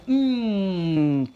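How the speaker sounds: long contented "mmm"; savoring. A narrator's reading. Puppy chewing the liver treats, savoring. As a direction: hum with pleasure over a mouthful.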